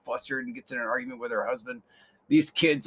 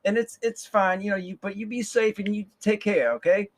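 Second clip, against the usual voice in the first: motherly coworker; 6 semitones higher, 5 dB louder than usual. Retold or imitated.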